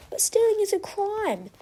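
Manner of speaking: high nervous stammer. A narrator's reading. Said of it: "stammers Rock".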